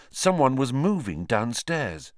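plain reading voice

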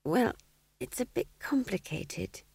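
feeble, hesitant explanation; weak. A narrator's reading. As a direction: trail off weakly, a little high. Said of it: "said Magrat weakly".

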